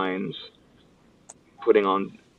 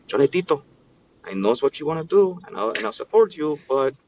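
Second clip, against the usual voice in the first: much higher, strict Ecuadorian grandma voice; grandmother impression; 8 semitones higher than usual. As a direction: imitate your strict grandma from Ecuador, pitched way up. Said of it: "she always talked like this".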